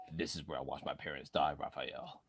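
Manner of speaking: low, quiet Batman growl; a weak low Batman impression. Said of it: "Batman voice".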